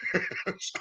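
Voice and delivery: soft breathy burst of real laughter; can't hold back a quiet laugh. Real, not acted. Medium laughing at a spirit's cheeky joke. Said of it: "Sorry, I'm laughing".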